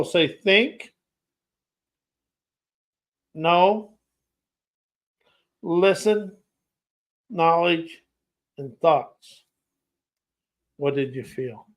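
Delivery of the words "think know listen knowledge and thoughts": long pauses between single words; extra slow. Shown as a demonstration. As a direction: leave each word hanging before the next one. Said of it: "I'm gonna go slow".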